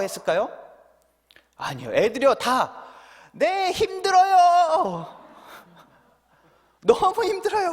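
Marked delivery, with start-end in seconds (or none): 3.3-7.7 put-on high, slow childish wail of hard times; whiny kids crying out. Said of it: "막 이런 소리를 지르면서 이야기합니다".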